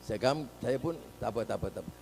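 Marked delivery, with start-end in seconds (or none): 1.2-1.9 low, very soft repeated refusal; quiet brush-off. Retold or imitated. Politician declines the youths' attention, low and hushed. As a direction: wave them off under your breath.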